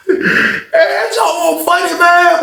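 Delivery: high amused exclaim; laughing. Real, not acted. Teller laughing at the fight, high voice. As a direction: still cracking up.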